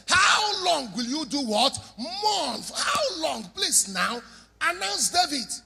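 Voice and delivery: high, loud, insisting he act now; loud exasperated demand. Acted. God shouts at Samuel to stop mourning. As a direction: shout at him to get on with it.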